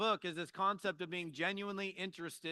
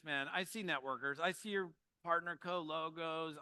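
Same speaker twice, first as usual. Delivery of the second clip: ordinary delivery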